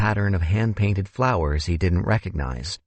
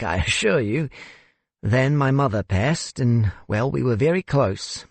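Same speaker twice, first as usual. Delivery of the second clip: put-on higher-pitched, wistful older man; South African host reminiscing; 5 semitones higher than usual. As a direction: recall work and a lost mother wistfully.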